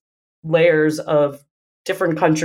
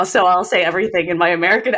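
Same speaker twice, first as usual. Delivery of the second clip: normal talking voice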